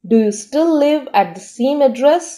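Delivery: slow, careful dictation pace; teacher reading an example sentence very slowly. Shown as a demonstration. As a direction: read it out slowly for learners to follow. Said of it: "sentence में इसका use देखते हैं".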